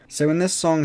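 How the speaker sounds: usual speaking voice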